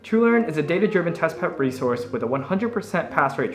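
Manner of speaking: upbeat sponsor read voice. Acted. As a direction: sell it brightly like an ad.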